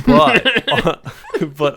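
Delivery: high, loud laugh breaking into the words. Real, not acted. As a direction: burst out laughing while carrying on. Laughs at own virus joke.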